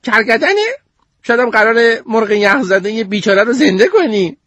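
put-on high, sarcastic girl voice; mocking girl. Goldie mocks Sneeze about the chicken, high sarcastic voice. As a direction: sneer at the idea.

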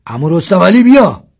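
high loud call; shouting. Acted. A boy calls to uncle Rostam Ali, shouting. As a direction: cry out to uncle from hiding. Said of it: "مدد هم داد زد".

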